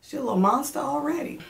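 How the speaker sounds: high, bright put-on voice for the midwife; quoted midwife. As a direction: lift it up into the midwife's playful voice. Quoting midwife at Doppler check.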